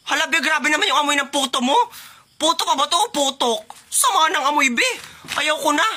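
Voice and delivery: high-pitched comic complaint about a stink; hammed-up disgust. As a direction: recoil in exaggerated, high-voiced disgust. Mock disgust at smelly puto.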